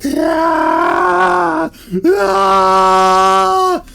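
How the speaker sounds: high, loud screams; man attacked by bear; distant screams. Acted. Wife hears his screams.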